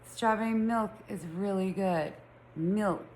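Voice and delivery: "milk" with a deliberate short i; standard pronunciation. Shown as a demonstration. Really having to try, careful short-i "milk". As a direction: make "milk" rhyme with "silk", a little self-conscious. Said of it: "It sounds silly when I say it like that".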